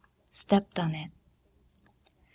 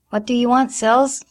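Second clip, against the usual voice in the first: raised voice calling upstairs; calling back; 5 semitones higher, 7 dB louder than usual. A narrator's reading. Mother answering her daughter from below, calling. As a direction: call up the stairs, a bit impatient.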